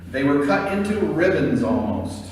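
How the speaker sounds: ordinary talk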